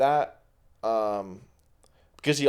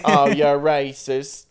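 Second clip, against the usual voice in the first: attempted Australian accent, coming out British; 2 semitones higher, 6 dB louder, slower than usual. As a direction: try for Australian but land on British. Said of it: "it keeps being British".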